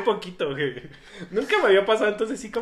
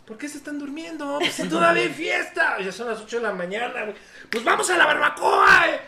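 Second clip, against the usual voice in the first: rowdy party shout; 8 semitones higher, 4 dB louder than usual. Retold or imitated. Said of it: "llega el punto que".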